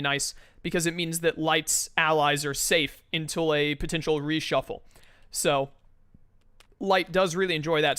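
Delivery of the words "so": throat catching mid-sentence; something stuck in the throat; frog in throat. Real, not acted. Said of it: "Sorry, I had something in my throat there".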